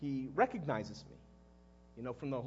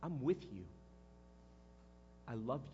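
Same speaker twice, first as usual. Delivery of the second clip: soft, lower and sincere; tender pledge; 6 semitones lower, 9 dB quieter than usual. Acted. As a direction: quietly assure him of your devotion.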